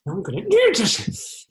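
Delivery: breaking into a high, loud command; agitated threat. Acted. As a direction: lose control and shout the threat. Threatening a captured attacker.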